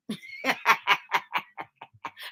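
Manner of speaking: trailing breathy laugh; long, tickled chuckle; amused cackle. Real, not acted. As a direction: laugh at your own impression. Laughs at her own demo.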